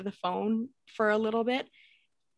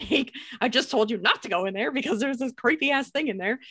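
retold exasperation, a little raised; mildly indignant protest; 4 semitones higher, 4 dB louder than usual. Daughter protesting as mom walks into the house, mild indignation. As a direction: protest it like a teen nobody listened to.

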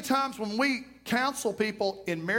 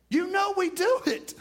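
much higher, teasing; knowing call-out; 9 semitones higher than usual. Real, not acted. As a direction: call them out with a grin. Preacher calls out congregation.

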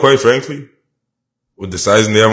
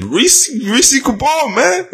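much higher, slow stretched name call; drawn-out hype call; much slower than usual. Real, not acted.

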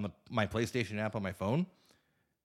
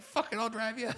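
put-on laughing drunk bravado; 13 semitones higher than usual. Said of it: "You're like".

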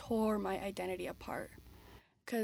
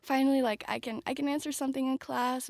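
higher, louder burst of relief; finally knowing the answer, bursting out; fast, eager mock cheer in class; excited relief; 3 semitones higher, 4 dB louder, faster than usual. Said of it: "I'd be like".

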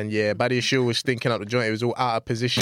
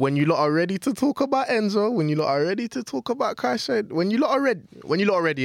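high teasing taunt; 7 semitones higher than usual. Real, not acted. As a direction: goad them, each time higher. Taunting rival fans, rising.

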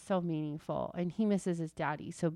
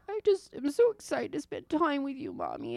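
higher, lightly voiced little boy; imitating her son; 7 semitones higher than usual. Mom voicing her son saying he misses her, lightly childlike. Son's words retold to mom.

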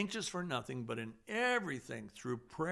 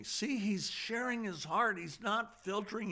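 ordinary delivery

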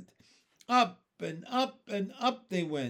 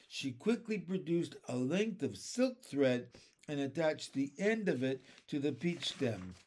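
ordinary delivery

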